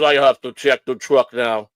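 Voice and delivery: put-on slow Polish-accented English. Foreign inspector to the van's owner, Polish accent. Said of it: "he sounded Polish to me".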